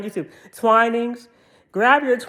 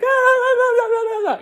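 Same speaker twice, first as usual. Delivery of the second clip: very high hollering nonsense sing-song; high, hammed-up hysterical wail; mock hysterics; 3 dB louder than usual. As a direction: ham up a hysterical movie character. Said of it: "crying and hooping and hollering".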